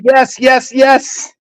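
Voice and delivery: high-pitched mock scream at normal volume; imitated excited screaming. Accuser quoting her friend's screaming, mock-excited and high. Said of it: "And you were screaming".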